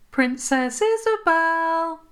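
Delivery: high calling voice from far off; faint far-off call; distant call. A narrator's reading. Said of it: "Queen Mummy's voice sounded very far away".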